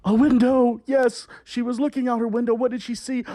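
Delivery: hammed-up storyteller for a young audience; storytelling to children. A narrator's reading. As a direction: tell it like a melodramatic storybook. Reads fairy tale to kids.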